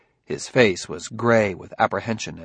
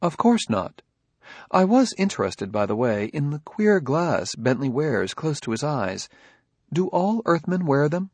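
higher, courteous, curious robot; polite robot Daniil; 5 semitones higher than usual. Acted. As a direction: inquire politely as a humanlike robot.

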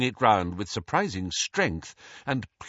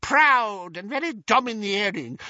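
put-on scandal-loving old lady, leaping high; swooping elderly busybody; gossiping old aunt; 9 semitones higher than usual. A narrator's reading. Muriel ignores him, goes on.

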